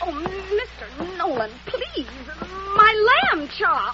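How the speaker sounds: grab any excuse to escape; flustered plea. Acted. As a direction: panicky dithering protest. Fending off the boss's advances.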